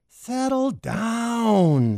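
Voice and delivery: very slow, high fish voice; slow drawn-out fish voice. A narrator's reading. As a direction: soothe like a lazy fish. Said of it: "said a fish in the tank".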